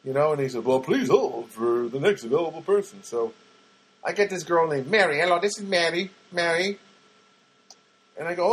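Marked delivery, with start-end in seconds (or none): 0.6-3.0 recorded hold-message voice; automated phone guy. Retold or imitated. Phone system asking the caller to hold, automated voice. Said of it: "the automatic guy". 5.2-6.8 lightly imitated Filipino-accented phone agent, higher. Call center agent greeting a caller, Filipino accent. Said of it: "she sounded Filipina".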